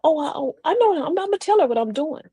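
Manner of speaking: acted flustered eagerness; willing, eager reply. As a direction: answer readily, happy to share. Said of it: "the first one you feel".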